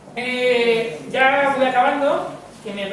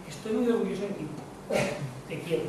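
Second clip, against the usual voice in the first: soft retold father; 4 semitones lower, 7 dB quieter than usual. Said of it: "Y se puso a llorar".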